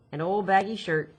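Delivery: ordinary delivery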